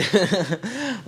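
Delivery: short high laugh; laugh, light and breathless. Real, not acted. Laughs at own joke.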